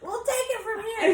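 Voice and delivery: high, loud, laughing parent voice; mock parent voice. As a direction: play the parents taking over. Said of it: "we're like".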